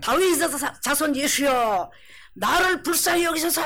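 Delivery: crying out repeatedly. Acted. Beggar calling out to Jesus yet again, shouting. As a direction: shout it out insistently, stretching the words. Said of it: "이 말을 한 번만 했겠어요?".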